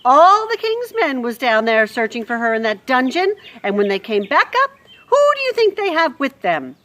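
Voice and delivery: loud harsh Louise. Acted. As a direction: rant about the dungeon news.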